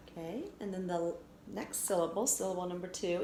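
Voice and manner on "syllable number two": usual speaking voice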